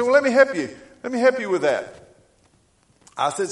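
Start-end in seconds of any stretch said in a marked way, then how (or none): 0.0-1.9 much higher, louder eager offer; bright, teasing eagerness; playful offer. Retold or imitated. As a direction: jump in eagerly to help. Preacher teasing caller.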